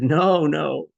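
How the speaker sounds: retold loud, high "No, no"; emphatic reassurance. Woman correcting the speaker about the friend's joke, emphatic reassurance. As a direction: jump in loudly to correct a misunderstanding. Retold quick denial of being rejected.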